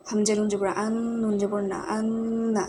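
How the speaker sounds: আন্না with the n hummed through the nose; nasal gunnah. Shown as a demonstration. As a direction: hold the ন in আন্না as a long nasal hum. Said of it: "এখানে এই নুনটা গুণনা হবে".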